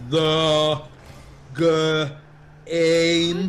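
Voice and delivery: loud, halting sounding-out; fake bad reader. Classmate faking bad reading in class, loud and halting. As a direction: read it like an idiot, word by painful word. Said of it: "he used to read like an idiot".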